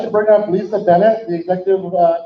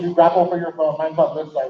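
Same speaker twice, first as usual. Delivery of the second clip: ordinary talk, nothing marked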